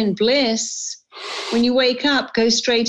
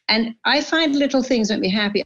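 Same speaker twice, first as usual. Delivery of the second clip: normal talking voice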